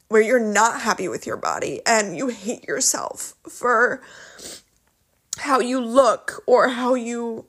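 speaking through lingering tears; emotional. Real, not acted. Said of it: "I'm getting so emotional".

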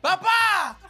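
high call to dad. Retold or imitated. Kid calling his dad at the family party, high call. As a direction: shout papá up high.

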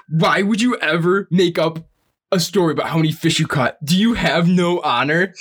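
hammed-up scolding, slowing on the last question; mock indignant. Angry fisherman confronting a fake catch post, mock outrage. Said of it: "he was so mad".

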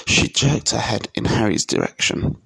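ordinary delivery

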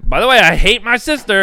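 high, loud blurt; over-the-top waitress outburst; imitated quirky waitress. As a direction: blurt it out to the whole table.

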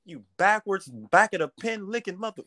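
mock cussing baby voice. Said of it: "He like".

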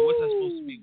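high, loud indignant question; indignant retort. Retold or imitated. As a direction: push back in disbelief. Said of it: "I'm like".

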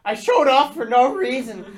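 high, loud defeated whine; mock despair. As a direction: wail it in fake despair. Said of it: "And I'm like".

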